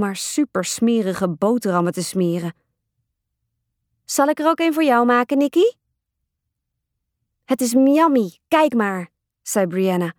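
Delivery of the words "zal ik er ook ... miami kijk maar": high-pitched little-sister voice; eager little sister. Acted. Brianna offering her sister a messy sandwich, eager.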